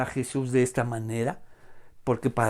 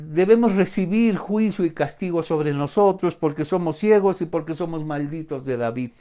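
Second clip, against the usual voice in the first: normal talking voice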